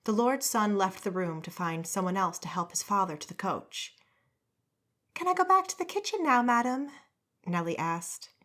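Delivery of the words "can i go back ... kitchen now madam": put-on high, quick young girl; servant girl voice. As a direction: ask eagerly like a young maid. Servant girl asks timidly.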